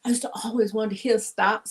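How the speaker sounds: ordinary talk, nothing marked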